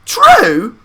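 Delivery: high, loud astonished cry; cried out. A narrator's reading. Four old grandparents crying out at Charlie's question, loud high cry. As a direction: burst out in disbelief. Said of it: "cried all four of them at once".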